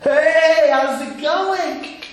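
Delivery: loud, high, drawn-out mock greeting; breezy check-in. Once-a-year visitor greeting God, exaggerated breezy hello. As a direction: hail a barely known acquaintance, stretching it out. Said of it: "checks in once a year".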